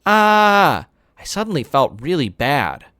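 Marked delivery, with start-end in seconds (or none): 0.1-0.9 mock scream. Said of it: "just going".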